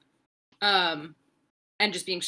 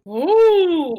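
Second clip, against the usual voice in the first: loud, high drawn-out ooh; big impressed reaction; mock awe; 8 semitones higher, 10 dB louder than usual. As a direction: swoop up loud as if wowed. Retelling reaction to the reveal.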